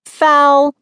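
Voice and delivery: loud, high referee-like call; announced. A narrator's reading. Sister calling a foul on sibling, announcing. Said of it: "Mindy announced".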